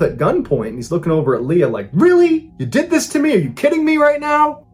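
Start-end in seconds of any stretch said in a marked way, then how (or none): 1.9-4.6 much higher, stunned disbelief; incredulous. Retold or imitated. Cop at gunpoint asking his date how she could, incredulous. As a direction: squeak out the betrayal in shock, pitched way up.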